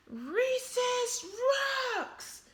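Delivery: high, loud bellow; wild shark bellowing. Acted. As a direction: bellow it at the whole playground. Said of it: "bellowed Clark the Shark".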